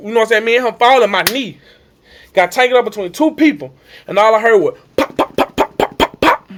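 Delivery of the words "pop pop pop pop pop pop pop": rapid unvoiced imitated pops; popping noise. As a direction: imitate a joint popping over and over. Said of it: "heard a popping noise like that".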